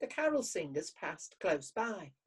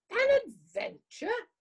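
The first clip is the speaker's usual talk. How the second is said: much higher, louder squeaked disbelief; 5 dB louder than usual. Acted. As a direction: squeak out the word in shock. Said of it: "squeaked Hedgehog".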